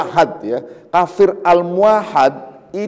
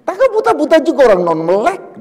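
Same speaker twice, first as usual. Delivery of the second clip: higher, louder, quick teasing retort; mock retort; 9 semitones higher, 5 dB louder, faster than usual. Imagined speaker retorts about the blind man, quick and teasing. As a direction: toss it back fast and cheeky.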